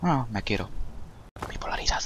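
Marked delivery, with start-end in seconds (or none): 1.5-2.0 whispered joke to himself; whispered aside. Real, not acted. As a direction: whisper it like a secret. Aside joke after hating himself.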